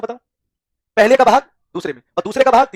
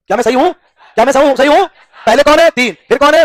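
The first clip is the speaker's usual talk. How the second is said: higher and louder, pushing the class for answers; energetic classroom quizzing; loud rising questions; 4 semitones higher, 6 dB louder than usual. Real, not acted. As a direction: call out to the whole room, rising each time. Teacher drilling class loudly.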